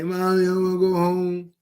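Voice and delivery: put-on whiny kid begging mommy; mock a scared kid crying for mommy. Speaker mimics inmate calling his mom, mock whiny child. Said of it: "You calling your mommy and daddy".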